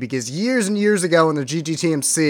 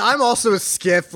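bad Russian accent; 7 semitones higher than usual. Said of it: "doing even more terrible impersonations".

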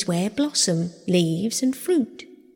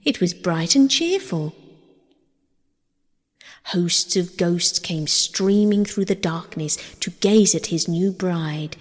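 plain reading voice

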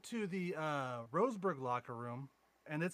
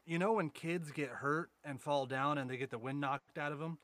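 ordinary talk

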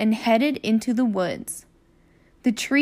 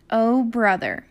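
ordinary delivery

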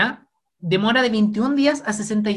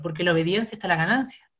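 ordinary talk, nothing marked

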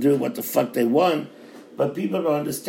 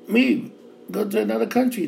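a sharp high order to get out; one sudden raised word; 6 semitones higher than usual. Real, not acted. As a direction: shout the word leave high. Ranting at country bashers.

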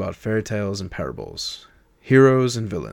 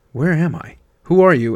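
higher, louder, bewildered; frantic questions; 7 semitones higher, 8 dB louder than usual. Acted. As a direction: demand answers in a panic. Simon overwhelmed and demanding answers.

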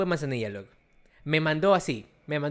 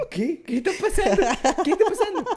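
higher, louder laughing disbelief; baffled; 12 semitones higher, 6 dB louder than usual. Retold or imitated. Retelling his own shock to his friend, high laughing disbelief. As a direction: ask it like nothing makes sense. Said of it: "Y yo".

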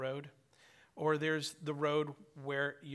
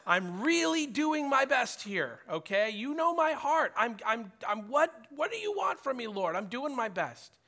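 emphatic pleading; 9 semitones higher, 5 dB louder than usual. Retold or imitated. Man pleads with the Lord to accept his best, loudly. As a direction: push to convince, almost arguing. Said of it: "emphatically trying to convince the Lord".

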